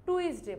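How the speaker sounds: টুইসডে as "tu-is-day"; two vowels, u then i, in টুইসডে; common mispronunciation. Shown as a demonstration. Teacher showing the other common form, too-is-day pronunciation. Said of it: "অনেকে এটাকে কি বলে?".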